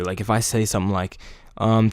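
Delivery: ordinary delivery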